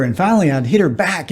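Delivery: ordinary delivery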